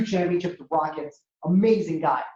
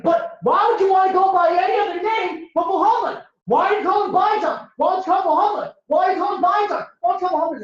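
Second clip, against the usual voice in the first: very high, loud exasperated questioning; baffled outburst; 12 semitones higher, 4 dB louder, faster than usual. Acted. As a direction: hammer the questions in a high, worked-up kid's pitch. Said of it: "Palestinian kid's even more confused".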